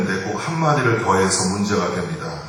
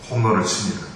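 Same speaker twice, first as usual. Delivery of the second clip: normal talking voice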